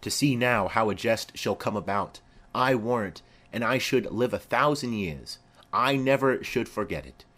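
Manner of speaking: normal talking voice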